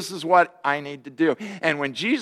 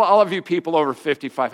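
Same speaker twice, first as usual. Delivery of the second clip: ordinary talk, nothing marked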